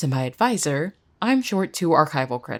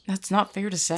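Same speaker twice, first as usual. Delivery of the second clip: normal talking voice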